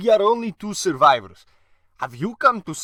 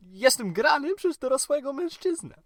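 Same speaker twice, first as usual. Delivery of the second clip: put-on a boy's voice pitched up; little boy voice; 8 semitones higher, faster than usual. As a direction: play the kid, pitched high. Grandson kid voice in Polish.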